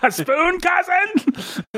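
very high, incredulous villain send-up; squeaky overdone actor impression; Alan Rickman. Co-host mocking the other's Rickman, high hammy impression. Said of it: "Is that how I do Rickman?".

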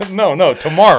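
laughs through the punchline; punchline cracked with laughter; laughing. Real, not acted.

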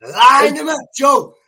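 real rowdy call-out; calling out a challenge. Real, not acted. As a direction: shout it over the others' chatter. Calling for opponents to be lined up.

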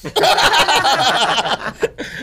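laughing out loud, high and long; real loud laugh; loud burst of laughter. Real, not acted. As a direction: crack up at your own story. Big laugh at own story.

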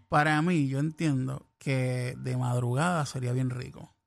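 sultry voice. Acted. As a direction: purr it like a late-night crooner. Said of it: "tiene una voz sensual".

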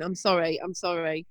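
ordinary talk, nothing marked

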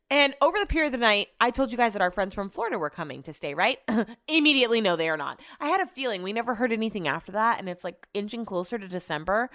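ordinary talk

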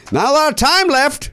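put-on Southern accent. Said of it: "she had a Southern accent".

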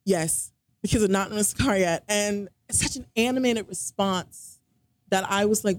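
ordinary delivery

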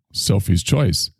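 normal talking voice

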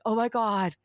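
mock dismay with a laugh breaking through; retold alarm. Son tells parent the dog ate the brownie, retold alarm.

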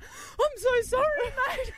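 high, teary retold sorry; hammed-up crying apology; mock tearful apology. Player apologising to the teammate she poked in the eye, mock sobbing.

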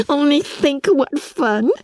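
high, slow, laughing through the words; can barely speak for giggling. A narrator's reading. Lydia giggling over the dressed-up joke.

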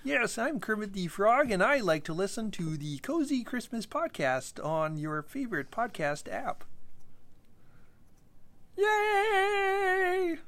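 high frog puppet voice; high, bright frog puppet voice; Kermit the Frog voice. Pretend. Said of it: "Your Kermit the Frog impression is really good".

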